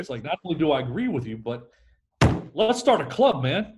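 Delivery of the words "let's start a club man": mock-enthusiastic, higher and louder; hammed-up overeager agreement; aggressively agreeing. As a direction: gush agreement like a new best friend. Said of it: "it's aggressively agreeing with them".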